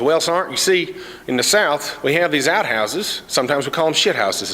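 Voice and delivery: patient hillbilly explainer. Retold or imitated. Cletus explains saying.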